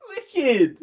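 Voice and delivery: put-on high, slow laughing kid voice; giddy kid impression. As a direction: play a clueless excited twelve-year-old. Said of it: "We thought".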